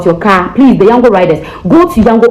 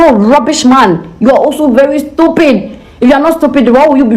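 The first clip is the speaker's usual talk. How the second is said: higher-pitched mock rebuke; retold insult; 5 semitones higher than usual. Woman retells cursing the driver, higher and sharp. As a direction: toss the insult back, pitched up. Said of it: "And I'm saying".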